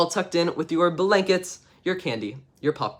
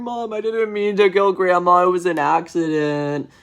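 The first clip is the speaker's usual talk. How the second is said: put-on pleading child; 4 semitones higher, 4 dB louder than usual. Child David confessing in story.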